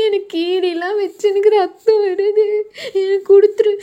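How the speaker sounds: put-on a hurt kid sobbing as she demands the dress; crying demand. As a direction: cry it out and demand it back. Sister scratched, acted crying fight.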